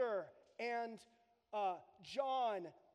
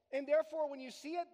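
usual speaking voice

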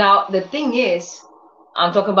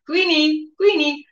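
calling the dog; 8 semitones higher than usual. Retold or imitated. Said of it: "If I call her".

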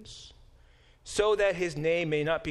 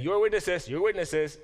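insistent repeating; 5 semitones higher, 5 dB louder than usual. Retold or imitated. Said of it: "he's been saying".

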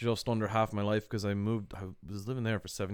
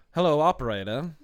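put-on raised, louder old-timey mid-Atlantic voice; old-timey mid-Atlantic accent; 6 semitones higher, 8 dB louder than usual. Shown as a demonstration. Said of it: "mid-Atlantic accent".

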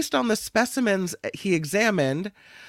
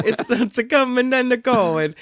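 higher, louder hammed-up Italian accent; mock Italian; 5 semitones higher, 6 dB louder than usual. Host playing along with co-host's joke, mock Italian. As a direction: play along in cartoon Italian.

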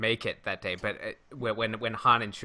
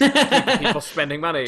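mock echo; 7 semitones higher, 8 dB louder, faster than usual. Echoes the quip in a put-on voice.

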